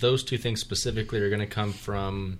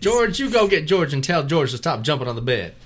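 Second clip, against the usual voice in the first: loud, higher parent calling out; exasperated parent yelling; 6 semitones higher, 8 dB louder than usual. Pretend. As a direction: yell across the house at the kids.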